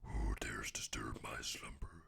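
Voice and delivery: deep, hissing whispered growl; hissing reptile growl. Acted. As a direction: rasp it out like a huge hidden serpent. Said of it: "something like this".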